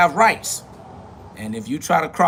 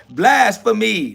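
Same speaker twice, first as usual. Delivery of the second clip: slow, loud, drawn-out cry of blasphemy; mock accuser; 5 semitones higher, 7 dB louder, much slower than usual. Imitating a commenter crying blasphemy, slow and loud. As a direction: ham up the outraged critic. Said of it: "Somebody said".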